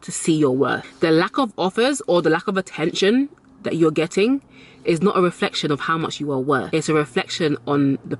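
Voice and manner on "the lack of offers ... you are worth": ordinary talk, nothing marked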